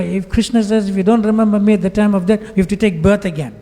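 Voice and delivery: a worried student arguing his case; insistent worried disciple. Retold or imitated. Disciple argues with scripture quotes.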